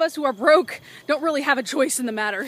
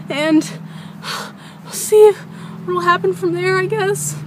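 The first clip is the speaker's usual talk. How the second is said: scared; 3 semitones higher, 6 dB louder than usual. Real, not acted. Hiding in shed, scared recording.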